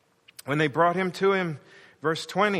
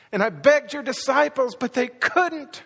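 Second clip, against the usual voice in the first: higher, pleading cry of agony; anguished father; 6 semitones higher, 3 dB louder than usual. Acted. Father coming to Jesus about his son, anguished. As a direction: stretch it out in pained frustration. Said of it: "The agony of that father".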